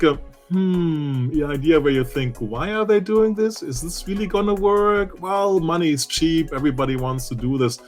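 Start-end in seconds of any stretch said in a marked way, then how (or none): none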